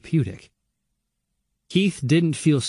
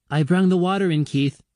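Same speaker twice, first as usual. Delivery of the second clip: put-on young girl voice; 7 semitones higher than usual. A narrator's reading. Young Anna reports to Keith.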